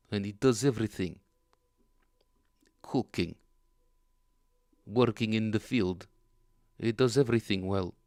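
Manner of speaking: keeps the loud mock Russian accent going; hammy Russian storyteller. Riffing on a viewer's comment in a mock Russian accent. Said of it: "I hope you don't mind the accent".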